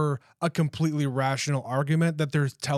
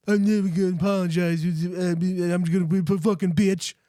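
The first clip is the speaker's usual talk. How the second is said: bratty trucker. Retold or imitated. Mocking the trucker's voice.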